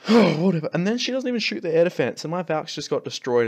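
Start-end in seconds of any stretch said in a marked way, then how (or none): 0.1-0.7 fed-up dismissal. Retold or imitated. Retelling queen ignoring targets.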